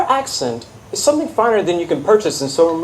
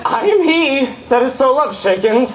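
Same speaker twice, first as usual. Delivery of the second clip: laughing; 5 semitones higher than usual. Acted. Laughing confession.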